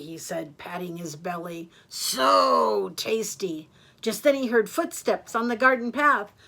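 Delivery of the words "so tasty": very drawn-out, louder relish; slow, smug gloating over a meal; savouring wolf. Acted. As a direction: stretch it out with greedy satisfaction. Wolf pats his full belly.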